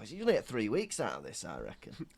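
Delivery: ordinary talk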